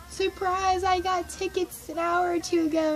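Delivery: high-pitched imitation of an excited mom; excited surprise reveal. Mom springs surprise tickets.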